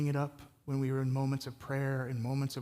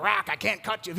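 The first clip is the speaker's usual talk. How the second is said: mock cornerman; 5 semitones higher, 6 dB louder than usual. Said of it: "He's like".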